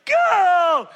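loud, high burst of go; mock shout. Parent sending kid off mid-story, mock shout. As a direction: fling out the word like a mock outburst. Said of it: "And I'm like".

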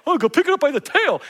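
a hint of a casual wife; breezy wife voice. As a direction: toss it off like it's nothing. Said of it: "exactly what my wife would say".

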